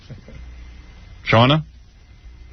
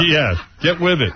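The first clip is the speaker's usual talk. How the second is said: laughing. Real, not acted. [name] laughing hard.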